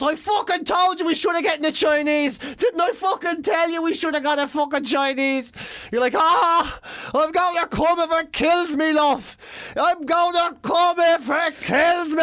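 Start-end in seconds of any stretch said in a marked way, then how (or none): 0.0-5.5 high, exasperated told-you-so rant; mock angry scolding. Girlfriend rounding on her partner, acted angry complaint. 6.2-12.2 high, strained, dragged-out yell; mock desperate strain. Man determined to finish, hammed-up straining cry.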